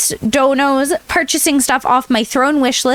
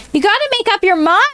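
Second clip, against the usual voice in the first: exasperated; 8 semitones higher, much faster than usual. Acted. Repeated faster and higher.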